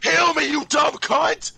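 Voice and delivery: furious yelling at a teammate; angry shout. Real, not acted. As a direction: scream insults, raging.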